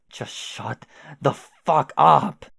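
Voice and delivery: slow, loud, full-throated yell; furious yell. Acted. Jane finally exploding at Jake, slow furious yell. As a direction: let the whole thing explode, word by word.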